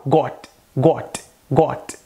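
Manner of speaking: "got" with rounded O and clear final T; Indian English accent. Shown as a demonstration. Teacher showing the final T, Indian accent. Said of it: "Já no inglês indiano soa".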